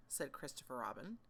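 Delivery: ordinary delivery, nothing marked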